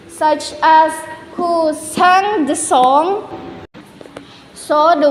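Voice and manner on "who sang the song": slow question with sang pitched high and drawn out; intonation demo. Shown as a demonstration. Student demonstrating an intonation example, slow careful question. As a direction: stretch out sang in Who sang the song, high and careful. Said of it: "Next is falling intonation".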